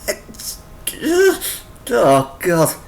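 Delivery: usual speaking voice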